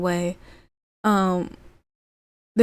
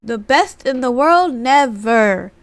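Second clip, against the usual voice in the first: high mocking mock innocence, louder; sarcastic; 5 semitones higher, 6 dB louder than usual. Rollins sarcastically mocking Punk, high mock-innocent sarcasm. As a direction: play innocent, dripping with sarcasm. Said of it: "Seth Rollins being sarcastic".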